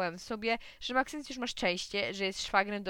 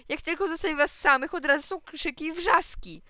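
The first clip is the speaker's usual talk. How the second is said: exasperated mother; 7 semitones higher, 8 dB louder than usual. Acted. Mother scolds noisy household.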